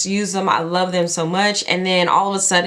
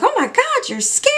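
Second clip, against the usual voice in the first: gushing compliment. Retold or imitated. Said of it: "she was like".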